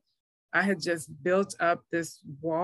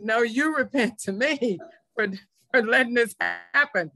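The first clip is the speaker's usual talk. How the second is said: higher, louder retold comeback to God; indignant retort; 4 semitones higher, 3 dB louder than usual. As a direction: throw the blame back, mock-indignant. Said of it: "I got even madder".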